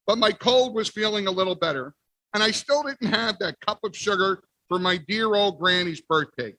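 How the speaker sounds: ordinary delivery, nothing marked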